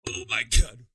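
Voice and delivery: loud, strained exclamation after a hit; choked by smoke. Real, not acted. Reacting to a huge steamroller hit, loud and choked. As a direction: force it out through a smoke-filled throat.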